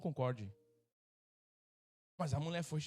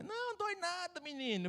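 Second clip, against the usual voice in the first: much higher mock female voice, light and dismissive; doctor's reassurance; 13 semitones higher than usual. Pastor imitating the doctor brushing off his fear, high mock voice.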